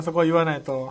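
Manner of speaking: ordinary talk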